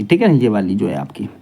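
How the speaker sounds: ordinary talk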